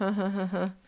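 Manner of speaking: a light put-on chuckle; a brief forced laugh; short laugh. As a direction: laugh the moment away. Ends the forced cheerful quote.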